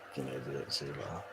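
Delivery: muttered under breath; muttering. Real, not acted. Muttered aside.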